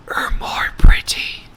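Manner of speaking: breathy whispered aside. A narrator's reading. Kung Fu Panda whispering in Hannah's ear. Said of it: "whispered to her very ear".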